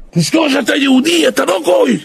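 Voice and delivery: high, pleading father's voice; father's urgent plea. Retold or imitated. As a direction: beg the child not to forget. Father urging his son. (תחינה של אב, בקול גבוה)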